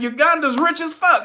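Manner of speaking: high pitched boast; mock president boasting. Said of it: "He said".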